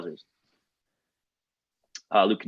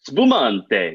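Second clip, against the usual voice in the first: exaggerated playful Italian accent, louder; fun Italian accent; 5 dB louder than usual. Shown as a demonstration. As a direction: say it like a hammed-up Italian. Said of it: "say it with a fun Italian accent".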